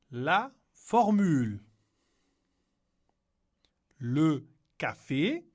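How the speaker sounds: slow, clear vocabulary drill; slow model reading. Shown as a demonstration. Teacher models menu words slowly for the class. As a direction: say each word slowly for learners to repeat. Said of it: "vocabulary list modelled slowly".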